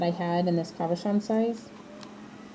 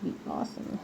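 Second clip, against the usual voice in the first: whispered; 12 dB quieter, faster than usual. Real, not acted. Muttered aside.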